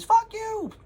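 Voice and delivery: shrieking the curse way up high and loud; piercing high mock yell; yelled insult. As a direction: hurl it like a bratty kid from the doorway. Kids yelling through the door.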